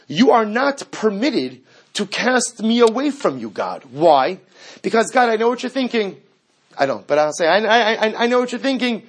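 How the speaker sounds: high, loud, insistent sermon voice; fiery sermon at full force; impassioned preaching. Acted. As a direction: drive it home to the congregation.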